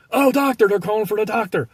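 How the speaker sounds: high, loud mock panic; mock alarm. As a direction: call out in exaggerated alarm for help. Acts out alarmed office staff.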